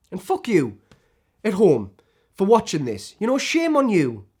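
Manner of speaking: indignant scolding, rising in pitch at the end; angry accusation. Acted. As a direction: lay the blame squarely on them. Turns accusation on viewers at home.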